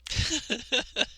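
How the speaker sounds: short breathy laugh; rueful laugh. Real, not acted. Laughing at years of self-hatred, a rueful laugh. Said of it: "I just have to laugh".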